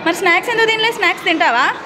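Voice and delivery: high, loud questions to a little one. Real, not acted. Mother asking son about homework and snacks, child-directed questioning.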